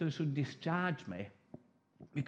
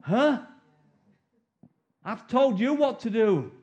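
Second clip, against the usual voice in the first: voicing God; 3 semitones higher, 7 dB louder, slower than usual. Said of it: "God's looking at us going".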